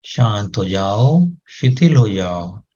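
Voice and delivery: slow, soothing repeated command; slow calming command. Shown as a demonstration. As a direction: lull the head into stillness. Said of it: "आदेश दें". (धीरे-धीरे शांत करता आदेश)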